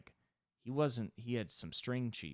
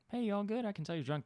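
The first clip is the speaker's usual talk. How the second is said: concerned mom voice; 12 semitones higher, faster than usual. Retold or imitated. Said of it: "when you're high around your mom".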